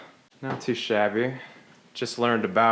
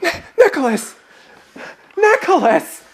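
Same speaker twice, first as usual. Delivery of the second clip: loud high desperate yelling; panicked yelling; 10 dB louder than usual. Acted. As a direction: scream for your friend. Yelling for help.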